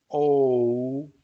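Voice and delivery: O held as one pure vowel with no glide. Shown as a demonstration. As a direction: say only the first part of the O. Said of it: "You just say the first part".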